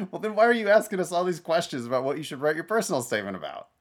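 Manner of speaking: high, fast, incredulous questioning; exasperated. Acted. Adviser to applicant who asked about the statement, exasperated. Mock reply to an applicant's excuse.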